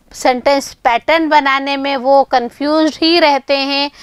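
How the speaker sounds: normal talking voice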